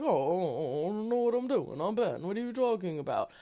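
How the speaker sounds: lightly mocking Italian accent, playing dumb; clueless Ben in a loose Italian accent. Vlogger mocking oblivious Ben with a light Italian accent. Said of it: "I made him sound Italian".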